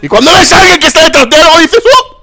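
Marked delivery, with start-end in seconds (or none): 1.8-2.2 sudden very high, loud "Uh"; startled falsetto "Uh"; mock squeal. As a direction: squeak it like you've spotted someone. Mocking a player spotting someone.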